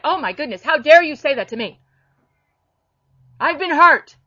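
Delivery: loud, wounded protest, voice jumping up; hammed-up hurt indignation; indignant outburst. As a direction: play the offended churchgoer who won't hear it. Offended listener protesting the rebuke.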